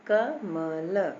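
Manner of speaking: क, म, ल slowly, each with a short "a"; letter-by-letter sounding. Shown as a demonstration. Teacher showing the short vowel in every letter, sounded out slowly. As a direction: space out क, म, ल. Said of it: "सब में छोटा आ निकल रहा है".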